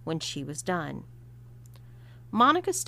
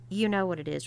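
usual speaking voice

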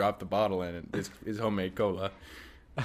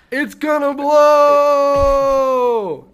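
long, high, very loud yell; panicked shout; 14 dB louder, much slower than usual. Retold or imitated. Worker warning everyone in the factory, with a drawn-out panicked shout. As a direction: scream the warning, dragging it out. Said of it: "obviously says".

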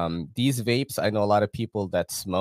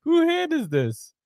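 amused; 4 dB louder than usual. Real, not acted. Teasing who made the ad.

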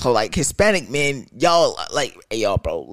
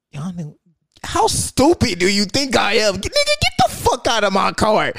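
mock loud scold; 10 semitones higher, 4 dB louder than usual. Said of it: "And I was like".

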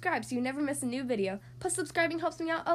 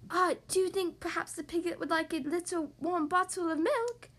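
lightly voiced little sister, lifting high at the end; young Barbara, hopeful suggestion; 4 semitones higher than usual. Younger sister suggests milk for the piglet, tentative character voice. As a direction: offer the idea tentatively, with a hint of character.

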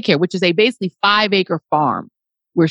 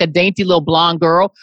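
normal talking voice

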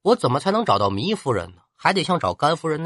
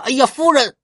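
higher, louder cry of alarm; shocked cry; 10 semitones higher, 6 dB louder than usual. Acted. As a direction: shout her name in horror. General sees her fall in well.

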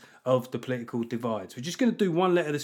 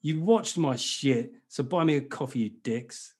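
ordinary talk, nothing marked

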